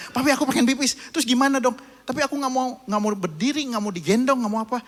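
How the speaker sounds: put-on a kid bargaining and refusing; whiny child. Son whines to papi that he needs to pee, high child voice.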